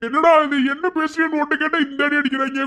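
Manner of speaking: high, loud aggrieved whine; pained complaint. Acted. Husband wailing to his friend about being beaten, high and loud. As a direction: wail it like the beaten victim.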